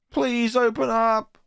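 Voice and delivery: high, slow desperate cry; a trapped girl begging at the door; high, drawn-out desperate plea. Acted. Trapped schoolgirl.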